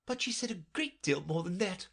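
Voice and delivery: a touch of high moaning lament. A narrator's reading. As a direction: moan it, wringing hands.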